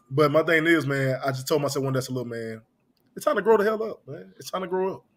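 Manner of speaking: ordinary delivery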